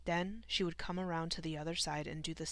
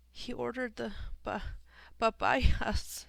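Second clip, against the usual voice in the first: high, broken sobs, bursting louder at the end; sobbing; 6 semitones higher, 6 dB louder, slower than usual. A narrator's reading. Grieving daughter cries over her father's last order, sobbing. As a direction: break down mid-word and sob it out. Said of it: "sobbed Esperanza".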